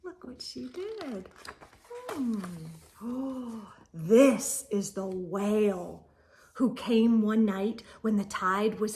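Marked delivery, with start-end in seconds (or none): none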